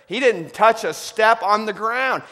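loud, climbing sermon voice; rising joyful preaching. Acted. Preaching at full force.

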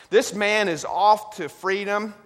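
loud, climbing sermon voice; rising joyful preaching. Acted. Preaching at full force.

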